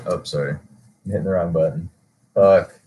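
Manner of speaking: usual speaking voice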